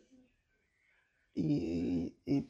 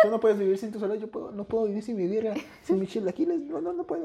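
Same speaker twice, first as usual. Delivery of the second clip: laughing; 7 semitones higher than usual. Real, not acted. Joking about missing birria.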